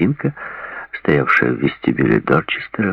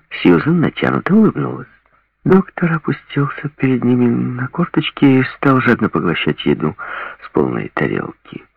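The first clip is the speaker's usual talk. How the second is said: plain reading voice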